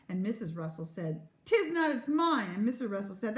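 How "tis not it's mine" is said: high, slow, emphatic contradiction; petulant quarrelling; childish retort. A narrator's reading. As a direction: insist like a stubborn child. Parents quarrelling over towel.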